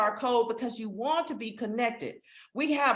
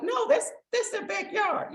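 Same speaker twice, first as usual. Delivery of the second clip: high, laughing mock quote of friends; amused high retelling; 11 semitones higher than usual. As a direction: toss it off high through a laugh. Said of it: "They were like".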